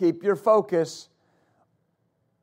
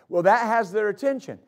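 ordinary delivery

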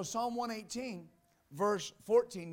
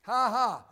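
short, loud "ha ha"; two loud preacher's ha-has; exultant laugh; 8 dB louder than usual. Real, not acted. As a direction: punch out a triumphant laugh. Exulting over the scripture read.

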